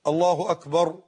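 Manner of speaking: «الله أكبر» with short opening hamza and short final vowel; correct takbeer. Shown as a demonstration. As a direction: say «الله أكبر» compactly, nothing stretched. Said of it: "طب الصواب إيه؟". («الله أكبر» بلا مدّ في الهمزة أو الباء)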